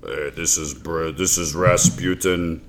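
low, soft Brad Garrett-style Rasputin; lightly voiced deep Rasputin. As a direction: drop the voice low and mumble it like a big guy. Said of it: "He probably sounded like, uh, Brad Garrett".

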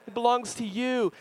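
hammed-up doting dad; mock overwhelmed gushing. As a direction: hand over everything in a melted rush. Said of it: "I'm like".

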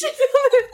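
a burst of high squealing laughter. Real, not acted. Laughing at own long story.

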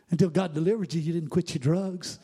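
normal talking voice

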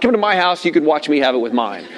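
usual speaking voice

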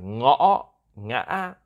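"ngõ" and "ngã" each broken and rising; northern ngã tone. Shown as a demonstration. Host showing the northern accent, "ngõ, ngã" broken and rising. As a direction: demonstrate the catch and rise on "ngõ", "ngã". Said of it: "in the northern accent, like".

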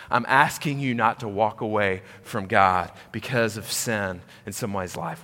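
usual speaking voice

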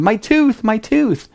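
high-pitched mock scream, not full volume; Marcia's scream. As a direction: imitate a pained cry in a high voice. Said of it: "I hear Marcia scream".

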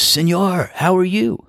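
put-on high, Spanish-accented greeting to a friend; cheerful Spanish-accented hello, voice pitched high. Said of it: "speaking with a Spanish accent".

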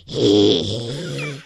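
deep far-off falsetto-style hee; mock "hee" cry. [name] imitation in area 51.

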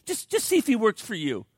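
high, groveling plea; hammed-up pleading, pitched high; mock pleading preacher. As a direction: beg like a desperate salesman. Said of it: "he's, like, pleading, he's groveling".

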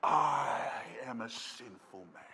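low, slow, hushed confession as Peter; remorseful confession. Acted. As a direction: sink down in shame, acting it out. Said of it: "That is the remorse kicking in".

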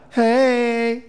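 bright, falsely cheerful brush-off; forced faith smile. Retold or imitated. Preacher imitating a believer faking being fine, forced cheer. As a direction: put on a brave smiling front. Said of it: "the faith smile on".

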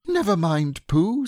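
high and gently soothing; comfortingly. A narrator's reading. Piglet consoles Pooh about being left out, comforting. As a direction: comfort a sad friend. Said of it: "said Piglet comfortingly".

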